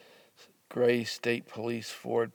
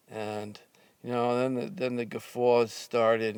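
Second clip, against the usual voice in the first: usual speaking voice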